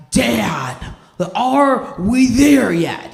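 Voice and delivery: put-on high, slow whining child; whiny kid. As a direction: drag it out like a bored kid.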